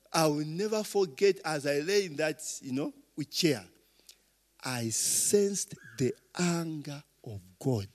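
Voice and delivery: ordinary talk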